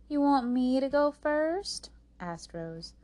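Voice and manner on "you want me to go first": high, loud, incredulous query; indignant question. A narrator's reading. Rose is asked to take her sister's turn, indignant. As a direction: ask as if it's outrageous.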